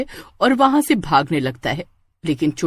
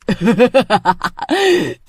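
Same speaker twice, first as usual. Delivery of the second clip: evil cackle; 2 semitones higher than usual. Acted. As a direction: cackle as she shows her true form. Witch reveals true form. (चुड़ैल की ज़ोरदार डरावनी हँसी)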